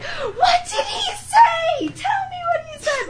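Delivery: very high, drawn-out mock wail; frantic retold demand. Friend retelling Kelsey begging for the secret, frantic demand. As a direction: mimic her pleading to know, voice way up high.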